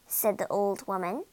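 plain reading voice

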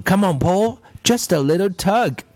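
high, coaxing little voice; wheedling pigtail voice, lightly acted; urging. A narrator's reading. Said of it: "urged the pigtail".

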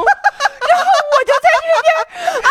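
high, drawn-out laughing shriek; laughing scream. Retold or imitated. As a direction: laugh through a frightened scream. Reenacts screaming at crab. (又笑又叫的拖长尖叫)

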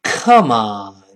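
loud burst falling low; angry foreigner. Acted. Acting a foreigner getting angry, loud angry exclamation. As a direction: burst out crossly, then drop. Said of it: "老外一生气说".

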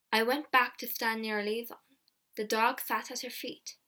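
ordinary delivery

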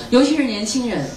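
ordinary delivery

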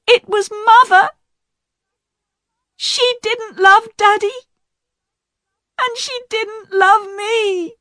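high, pleading, near tears; a young woman breaking down as she defends herself; tearful daughter. Acted. Diane to the inspector, crying as she confesses. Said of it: "Diane began to cry".

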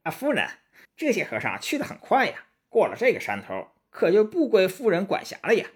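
high, excitable little imp voice; little demon minion. Acted. Small demon alerts the lady that monks are escaping, high minion voice. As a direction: report up to your mistress in high pitch.

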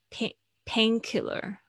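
painkiller with pain stressed high and slow. Shown as a demonstration. Teacher models painkiller stress for students, slow and high. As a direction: hit pain first, slow. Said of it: "重音通常都是在第一个字上面".

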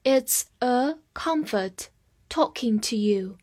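slow, carefully separated model reading. Shown as a demonstration. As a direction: read slowly with small gaps between words. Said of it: "放慢速度来一遍". (放慢速度清楚地读)

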